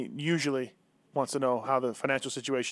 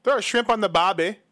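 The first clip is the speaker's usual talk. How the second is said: hammed-up Australian, louder and higher; mock Australian accent; 5 semitones higher, 5 dB louder than usual. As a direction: toss it off like a cartoon Aussie tourist. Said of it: "How's that?".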